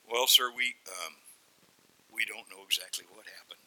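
low, halting excuse; sheepish pig herder. Acted. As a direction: stammer an explanation to the boss. Pig herders explaining to the owners.

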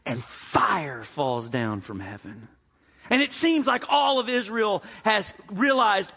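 ordinary delivery

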